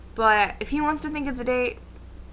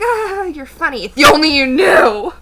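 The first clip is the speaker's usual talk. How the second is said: bitter humor; 4 semitones higher, 9 dB louder than usual. Retold or imitated. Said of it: "And I'm like".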